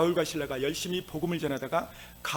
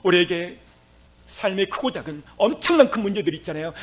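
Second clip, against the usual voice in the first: normal talking voice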